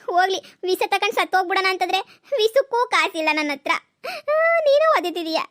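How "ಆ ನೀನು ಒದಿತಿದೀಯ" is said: high, exasperated cry at the cow; shrill complaint. Acted. Wife cries out high at the kicking cow. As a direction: throw it up high in disbelief.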